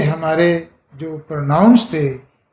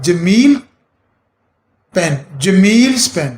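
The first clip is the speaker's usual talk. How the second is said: slow teaching example; slower than usual. Shown as a demonstration. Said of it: "آپ ایس لگاتے ہیں".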